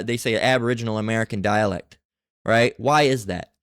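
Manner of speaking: ordinary talk, nothing marked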